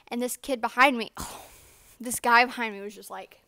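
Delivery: usual speaking voice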